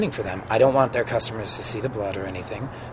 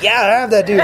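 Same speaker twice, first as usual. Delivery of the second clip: proud reply; 10 semitones higher, 8 dB louder than usual. Retold or imitated. Proudly answers fans on corner.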